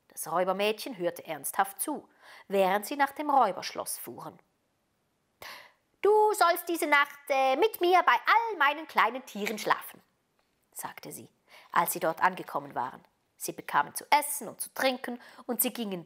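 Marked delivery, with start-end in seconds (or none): 6.0-10.0 put-on high, louder robber girl voice; bossy robber girl. A narrator's reading. Robber girl inviting Gerda to sleep by her animals, bossy. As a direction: announce it like a bossy child.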